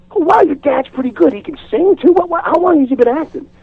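high-pitched imitated admiration; impressed amazement. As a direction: gush in surprise, imitating onlookers. Imitates impressed guys outside to daughter.

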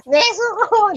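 high-pitched panicked call of a name, imitating Zenitsu; mock Zenitsu yell. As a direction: squeal the name out like scared Zenitsu. Imitating Zenitsu yelling for Nezuko. (panggagaya kay Zenitsu na sumisigaw nang matinis)

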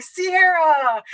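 high, drawn-out name call; imitated calling out. Coworkers wheel over to her desk, calling out.

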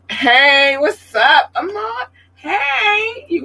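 high, bright sing-song hello; squealed hello to a commenter; excited greeting. Real, not acted. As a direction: call out to the viewer in delight.

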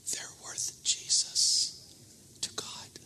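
soft, unvoiced, slow whisper of "They're worth Jesus to God". God speaks in the preacher's heart, hushed whisper. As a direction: breathe the words as a still small voice.

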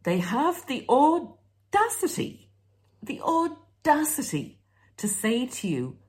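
high, slow sarcastic emphasis on audacity. Real, not acted. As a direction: drip with disbelief at their nerve. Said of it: "Sorry about the sarcasm".

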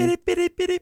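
high squeaky bidip-bidip beeping; mock telegraph beeps. Joking about telegrams on a podcast, high playful mock beeping. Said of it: "Was that two long beeps or one short beep?".

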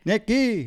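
high, loud "Nicky", KY punched; "Nicky" with a high-pitched, stressed ending; stress on second syllable. Retold or imitated. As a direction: echo the call of Nicky as nick-KEE. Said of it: "the emphasis on the second syllable".

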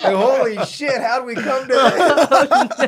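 loud, high, goofy put-on voice with laughter; Adam impression. Host shows off his impression for laughing friends, goofy Adam voice. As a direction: stretch it out in a comic actor's voice. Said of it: "It's my Adam impression".

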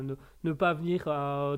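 normal talking voice

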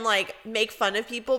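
usual speaking voice

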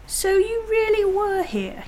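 high, slow, incredulous accusation; accusing realisation. Acted. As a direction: draw it out high, confronting a liar. Peter confronts Edmund's lie.

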